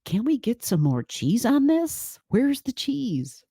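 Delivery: playful greedy voice begging for extra cheese; greedy Jupiter craving cheese. Pretend. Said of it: "It's like".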